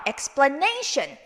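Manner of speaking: slow "explanation" with a long NA; stress on NA. Shown as a demonstration. Teacher modelling for repetition, stressed NA. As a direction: lean hard on NA in "explanation". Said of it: "전에다가 액센트".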